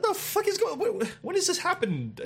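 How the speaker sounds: high, disbelieving yelp; shocked disbelief. Retold or imitated. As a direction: voice jumps way up in shock. Shocked at the closed store.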